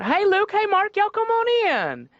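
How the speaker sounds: very high, slow effeminate Southern drawl; effeminate Southern Jesus. Retold or imitated. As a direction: sing out a greeting in a high Southern accent. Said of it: "an effeminate Southern man".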